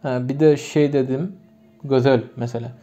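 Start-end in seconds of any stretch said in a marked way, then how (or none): 1.8-2.2 "Gözel" spoken with lip harmony, ö twice; rounded vowels. Shown as a demonstration. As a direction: say "Gözel" with rounded ö in both syllables, like gözöl. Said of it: "yazılır ama söylerken gözel".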